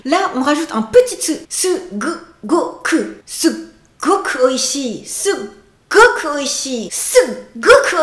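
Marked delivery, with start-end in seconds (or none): none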